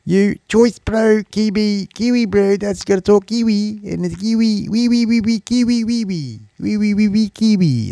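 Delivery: high squeaky Kiwi parody with piping vowels; mock Kiwi accent. Shown as a demonstration. Mocking how Kiwis talk, high silly Kiwi accent. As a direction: squeal it like a cartoon New Zealander. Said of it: "that's how you talk Kiwi".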